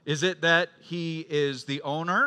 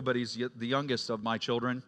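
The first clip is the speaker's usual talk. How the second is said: normal talking voice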